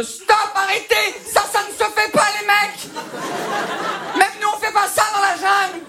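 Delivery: high-pitched, loud panicked protest; horrified Burmese junta soldiers. Acted. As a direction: cry out like appalled war criminals. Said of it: "des mecs de la junte birmane".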